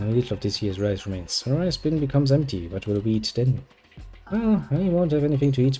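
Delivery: normal talking voice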